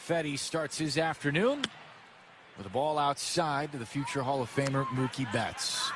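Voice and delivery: ordinary delivery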